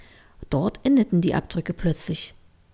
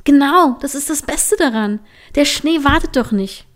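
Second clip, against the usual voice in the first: eager urging; 6 semitones higher, 6 dB louder than usual. Acted. Trini eagerly urging sleepy Line.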